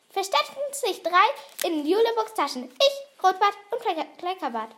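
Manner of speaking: high, loud little goblin voice; eager goblin. Acted. Fliegenbart tells who hid in the pockets, high eager goblin voice.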